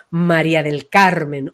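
slow, low, full naming; big grand name. Shown as a demonstration. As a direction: give the name a large place. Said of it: "ocupar un lugar grande".

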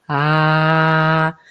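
low, open "ah" felt in the chest; grounded chest "ah"; heart-centred ah. Shown as a demonstration. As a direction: hand on the chest, sound a deep "ah". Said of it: "ponerse la mano y de sentir".

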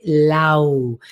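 "Lau" drawn out and turned inward; inward-held "Lau"; voice going inward. Shown as a demonstration. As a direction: let "Lau" sink inside. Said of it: "va más hacia adentro".

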